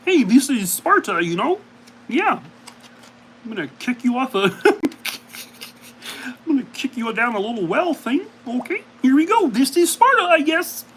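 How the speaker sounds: a Kermit the Frog Sparta; high squeaky frog-puppet voice. Retold or imitated. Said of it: "as done by Kermit".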